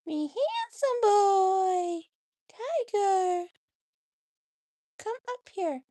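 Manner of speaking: high, very loud, slow coo. Real, not acted. Owner croons to her cat in high, loud, slow baby talk.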